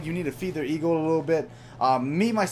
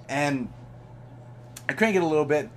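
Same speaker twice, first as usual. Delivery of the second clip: ordinary delivery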